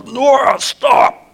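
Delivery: high, loud outside-voice yell; urgent yell. Retold or imitated. Dad yelling to his daughter running at a skunk, urgent yell. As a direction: yell to freeze her in her tracks. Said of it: "I yell".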